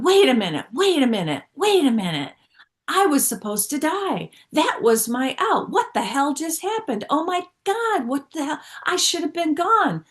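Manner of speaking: reliving the near crash, half yelling in disbelief; shocked outburst. Retold or imitated. Telling the group about the near crash, high, loud, stunned outburst. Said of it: "I went".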